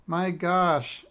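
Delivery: put-on incredulous child, read in character; astonished exclamation. A narrator's reading. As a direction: exclaim it, taken aback. Imogene reacts to the long name.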